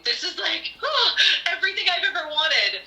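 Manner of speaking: high, gushing joy; mock-giddy delight; elated. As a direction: squeal it like a dream come true. Elated retelling of dream coming true.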